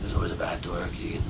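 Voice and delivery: hushed whisper. Real, not acted. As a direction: lean in and whisper the secret. Conspiratorial aside to friend.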